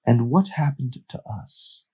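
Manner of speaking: gentle hurt; wistful. Acted. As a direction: ask it with regret. Carlos sad question.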